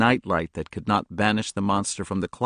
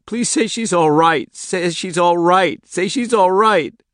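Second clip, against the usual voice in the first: put-on high, slow, wailing child; much higher, louder, slow pleading cry; desperate pleading; 12 semitones higher, 8 dB louder, slower than usual. A narrator's reading. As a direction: beg over and over in panic.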